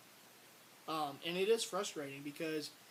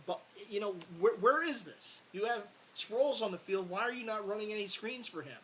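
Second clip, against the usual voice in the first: raised, higher, exasperated complaints at the coach; exasperated rant; 4 semitones higher, 4 dB louder than usual. Real, not acted. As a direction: vent at the coaching staff, pitch climbing. Fan ranting at play calling.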